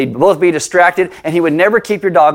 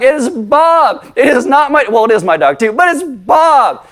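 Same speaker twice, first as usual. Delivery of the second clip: exasperated protest; 8 semitones higher than usual. Retold or imitated. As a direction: plead your case to the teacher, stretched out. Protesting to the teacher.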